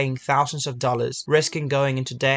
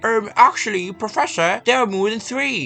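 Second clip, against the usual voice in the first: high, nasal-free smug correction; nerdy know-it-all voice; 7 semitones higher, 5 dB louder than usual. Retold or imitated. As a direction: play the smug um-actually kid. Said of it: "And Tony on the back is like".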